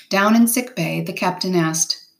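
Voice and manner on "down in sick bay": ordinary delivery, nothing marked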